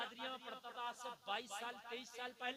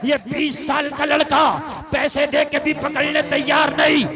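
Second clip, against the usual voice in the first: loud, ringing sermon delivery; fiery preacher's call; preaching at full force; 4 semitones higher, 26 dB louder than usual. Acted. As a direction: thunder it to the gathering.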